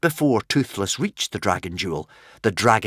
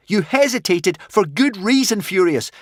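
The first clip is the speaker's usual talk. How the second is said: higher, louder urgent shout; 10 semitones higher, 4 dB louder than usual. A narrator's reading. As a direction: shout while struggling in the grip. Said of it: "shouted Hiccup".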